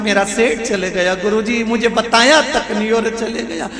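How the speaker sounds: mock wailing widow. Wife wails to the guru about her dead husband, mock sobbing. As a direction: ham up a widow's fake grief. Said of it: "सेठानी कहती है".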